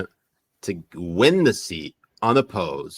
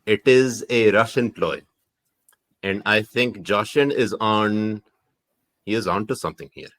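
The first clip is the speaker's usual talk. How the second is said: put-on Pakistani accent. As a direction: spin a theory in a Pakistani accent. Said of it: "Pakistani accent".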